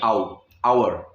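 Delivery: आउ drawn out then आवर slowly; stretched आउ. Shown as a demonstration. Teacher stressing the आउ start of our. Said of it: "आउ की आवाज़ आएगी स्टार्टिंग में".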